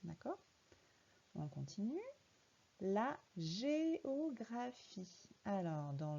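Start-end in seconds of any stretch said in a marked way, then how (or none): 2.8-5.3 géographie drawn out, syllable by syllable; each syllable of géographie held apart; slow syllable reading. Shown as a demonstration. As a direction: read it slowly for a child. Teacher reads word for child.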